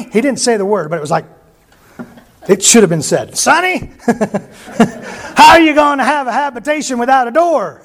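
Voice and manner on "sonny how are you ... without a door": imitating Bob. Imagined Bob retort, laughing.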